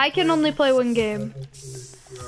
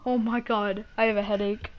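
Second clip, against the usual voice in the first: drained; 5 semitones lower, 8 dB quieter than usual. Real, not acted. Drained after the excitement.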